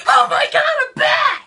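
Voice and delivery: breathy gasp then high, loud shriek; panicked shriek. Acted. Startled by a bat in the basement, hammed-up shriek. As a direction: scream as if a bat flew at you.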